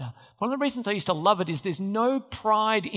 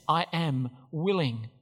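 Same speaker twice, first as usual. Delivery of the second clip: softer, lower and slow; quiet tender Jesus; 5 semitones lower, 5 dB quieter than usual. Retold or imitated. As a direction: promise it quietly and gently.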